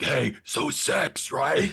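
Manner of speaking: slow breathy whisper-yell; stage whisper. Retold or imitated. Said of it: "that whisper yell?".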